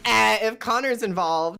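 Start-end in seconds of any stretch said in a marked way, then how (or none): 0.0-0.4 loud squawking cat noise; cat sound. Pretend. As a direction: blurt it like a cat. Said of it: "definitely sound like".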